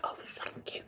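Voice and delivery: a soft, breathy whisper; whispered thanks. Retold or imitated. Thanking the woman who praised his smell, whispered. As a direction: whisper it, flattered and coy. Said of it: "I was like".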